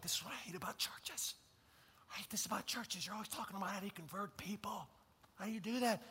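thin, soft put-on objection; hushed mock complaint. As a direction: imitate a critic under the breath.

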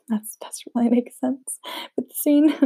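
real breathy giggle through the words; laughing. Real, not acted. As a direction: laugh helplessly at your own slip. Laughing at her own misreading.

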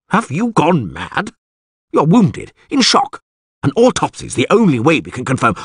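high-pitched, agitated wizard; alarmed protest. A narrator's reading. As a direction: protest in shrill disbelief. Wizard protests at drawn blade.

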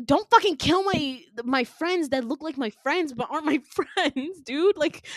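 retold screaming. Winter yelling at villain killing her friends, retold outrage. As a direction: protest frantically, half-screamed. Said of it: "she screams even louder".

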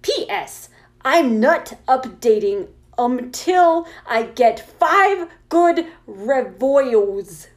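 emphatic, drawn-out indignant rant; character voice. Acted. Author refusing to update without reviews, indignant character voice. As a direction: punch out each misspelled word slowly. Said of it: "read it in my character voice".

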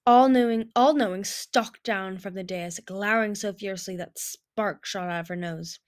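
usual speaking voice

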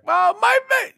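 high, broken-off mock sob; imitated sobbing child. Daughter sobbing over her unicorn, mock sobbing child. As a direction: imitate a child crying mid-word. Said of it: "a sobbing Zoe".

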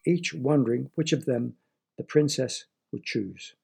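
plain reading voice

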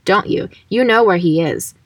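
ordinary talk

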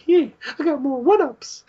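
very high squeal with laughter; Kagura. Retold or imitated. Girl bragging about extra mushrooms, high laughing squeal. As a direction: boast in a squeaky, giggly pitch. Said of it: "I love how Kagura's like".

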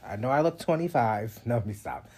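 ordinary talk

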